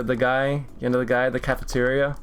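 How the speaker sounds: normal talking voice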